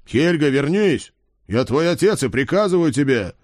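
calling out. Acted. Father calling daughter back.

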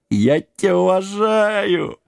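high, slow tipsy declaration; drunk giant. A narrator's reading. Giant pledges respect to king, drunken drawl. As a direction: draw it out with drunken devotion.

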